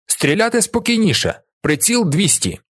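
high-pitched, brisk firing orders; energetic command. A narrator's reading. Stout commander directing boys at play-war, energetic orders. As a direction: drill the troops with energy. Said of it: "Він енергійно віддає команди".

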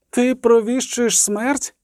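high, slow cry without extra volume. A narrator's reading. Triss challenging the voice foretelling death, restrained cry. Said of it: "крикнула Тріс".

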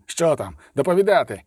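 a curt, martial order to report; warlike command. A narrator's reading. The coroner demands a report from his men, commanding. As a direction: bark the order at the troops. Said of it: "войовничою міною та голосом".

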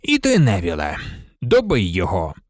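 low-pitched, mild command; understated low instruction; ordering. A narrator's reading. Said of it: "звелів Люпин".

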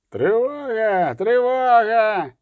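high, long drawn-out yell; alarm call. A narrator's reading. Pursuing guards raise the alarm, high drawn-out cry. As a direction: stretch the cry out high, as if calling afar.

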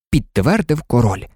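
plain reading voice

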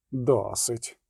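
ordinary delivery, nothing marked

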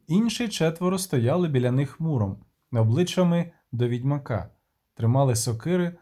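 ordinary delivery, nothing marked

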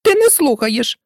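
high, cross mouse voice; furious scolding. A narrator's reading. Mouse catches Alice not listening, furious scolding. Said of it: "гримнула миша на Алісу розлютована".

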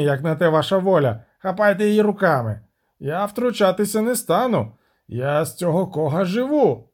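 put-on sailor voice, agitated; defiant captain. Captain refuses to risk his ship, agitated protest. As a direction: argue back as a stubborn sea captain.

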